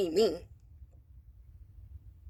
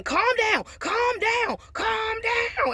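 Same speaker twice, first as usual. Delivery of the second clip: imitating screaming mother-in-law; 13 semitones higher, much slower than usual. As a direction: mimic her screaming at the bride, pitched way up. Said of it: "And she starts screaming".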